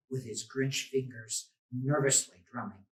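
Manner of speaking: plain reading voice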